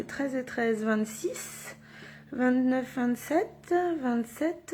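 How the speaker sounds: quiet counting to oneself; thinking aloud. Real, not acted. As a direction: work the sum out softly, half to yourself. Works out sums to herself. (calcul à voix basse)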